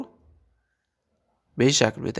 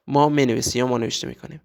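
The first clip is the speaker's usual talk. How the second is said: ordinary talk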